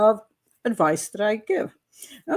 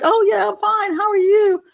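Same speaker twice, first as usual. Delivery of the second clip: much higher, louder carefree reply; breezy young self; 11 semitones higher, 5 dB louder than usual. Retold or imitated. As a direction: toss it off like a carefree kid, high and bright in pitch. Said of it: "And I'm like".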